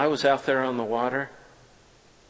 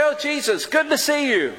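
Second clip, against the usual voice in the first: glad greeting; 14 semitones higher, 4 dB louder than usual. Pretend.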